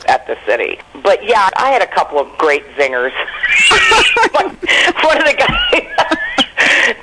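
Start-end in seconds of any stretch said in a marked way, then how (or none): 3.2-4.3 high-pitched cackle of amusement; burst of laughter. Real, not acted. Comic recalling great zingers to host, high burst of laughter. 4.3-7.0 high, slow, helpless laughter through the words; laughing while talking. Real, not acted. Comic starting a zinger story for host, cracking up.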